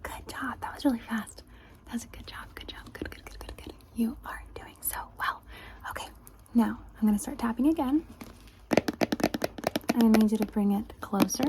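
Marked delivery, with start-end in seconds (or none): 0.0-6.1 soft, softer whispered praise; ASMR whisper. Acted. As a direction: whisper praise close and gentle.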